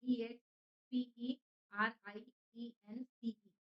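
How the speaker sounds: each letter of experience said slowly and loud, one by one; spelled out letter by letter. Shown as a demonstration. Teacher spelling experience aloud for students, slow and loud. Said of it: "experience".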